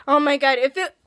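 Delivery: ordinary talk, nothing marked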